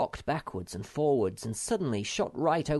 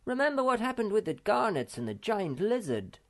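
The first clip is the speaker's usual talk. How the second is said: high, raised warning call; pitched-up cautioning call; 12 semitones higher than usual. A narrator's reading. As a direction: call across to warn a friend. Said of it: "called Snufkin".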